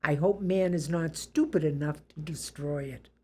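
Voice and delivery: ordinary talk, nothing marked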